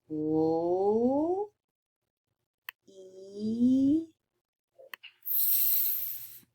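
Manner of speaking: each sound of leaf stretched apart; slow segmented sounds for children; sounded out slowly. Shown as a demonstration. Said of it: "say these word really, really slow".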